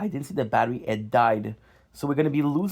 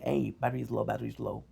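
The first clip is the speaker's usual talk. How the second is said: phone alert voice; 3 semitones lower, 8 dB quieter than usual. Retold or imitated. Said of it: "the phone telling me".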